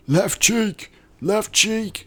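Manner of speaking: very high-pitched acted holler; urgent call-out. Voicing soldier calling out under fire.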